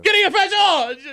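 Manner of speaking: loud, slow Italian street vendor call; Italian accent. Pretend. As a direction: shout it like an Italian fruit seller. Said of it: "That's exactly what it is".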